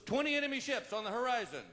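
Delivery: high-pitched cry from the lookout; lookout's alarmed call. Acted. Lookout warning of twenty enemy ships, high urgent call. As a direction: sing out the alarm from the crow's nest. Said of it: "the guy in the crow's nest goes".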